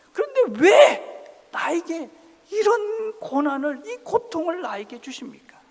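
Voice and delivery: high, loud anguished plea fading to a whisper; complaining prayer. Acted. Jesus complaining to God about suffering, anguished then hushed. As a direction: cry out why, then let it fall away. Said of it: "원망의 소리를, 저 불평을".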